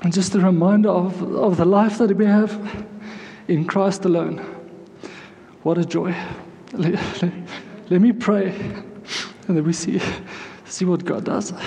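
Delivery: real emotion welling up, halting with long pauses; emotional, choked up. Real, not acted. Preacher overcome by God's grace before praying, choked up. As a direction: struggle to get the words out through emotion. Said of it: "I'm so emotional this morning".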